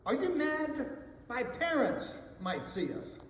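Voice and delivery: high-pitched horrified objection, lightly acted. A narrator's reading. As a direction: voice jumps up in mild alarm.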